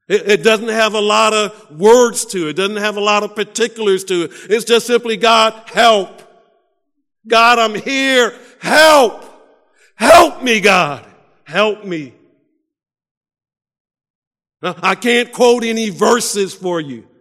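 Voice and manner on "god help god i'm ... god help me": rising to a loud desperate cry of "Help". Acted. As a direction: beg God louder with each help. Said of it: "he cries out the sweetest".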